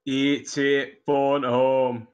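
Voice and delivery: lightly put-on Yorkshire ET line; a hint of Yorkshire on phone home; mock Yorkshire alien voice. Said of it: "Yorkshire E T".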